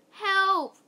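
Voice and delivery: high, loud storybook yell; cry for help. A narrator's reading. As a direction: call out for rescue. Story character cries out after cave-in.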